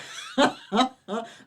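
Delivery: a loud, high laugh; burst of laughter. Real, not acted. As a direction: crack up at the joke. Laughs at her own joke.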